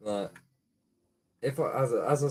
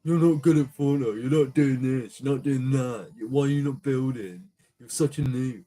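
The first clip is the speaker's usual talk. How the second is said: higher and louder sneering imitation; mock the taunting kid, loud and snotty; hammed-up whiny noob-caller; mocking gamer kid; 4 semitones higher, 6 dB louder than usual.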